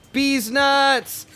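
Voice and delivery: loud, high-pitched triumphant yell; gleeful punchline shout. Real, not acted. Springs punchline of long story.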